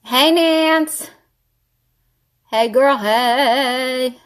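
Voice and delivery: sing-out hello, stretched long; drawn-out greeting. Real, not acted. Greeting Nance, slow drawn-out hello. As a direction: draw the last word right out.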